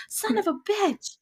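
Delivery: high, slow pained cry. Acted. Jack hurt in the fight, pained cry. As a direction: wrench it out in pain. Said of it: "Jack cried out in pain".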